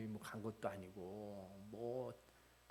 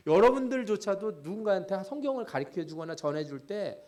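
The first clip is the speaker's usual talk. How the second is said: louder preaching voice, pressing rhetorical questions; impassioned preaching; 7 semitones higher, 9 dB louder than usual. Acted. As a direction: preach it out, challenging the congregation. Preaching at full force.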